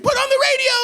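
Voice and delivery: very high, loud screech; piercing mock-drunk shout; imitated party-girl yelling. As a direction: shriek the demand from the back seat. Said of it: "screaming and yelling".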